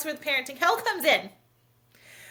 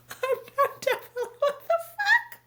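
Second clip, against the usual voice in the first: mock wail; 13 semitones higher than usual. Mom wailing after being roasted by her kid. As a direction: wail like the world just ended.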